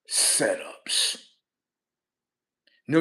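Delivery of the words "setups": whispered. Real, not acted. Catchphrase said under breath.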